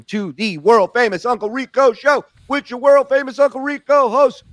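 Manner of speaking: big booming show-host intro; announcer voice. Acted.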